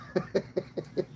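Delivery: short breathy burst of laughter; airy laugh. Real, not acted. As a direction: laugh under your breath. Watching the sprinkler cow.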